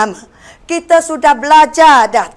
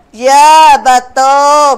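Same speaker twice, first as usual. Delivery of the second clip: loud praise; 5 dB louder, much slower than usual. Real, not acted. Teacher praising group answer.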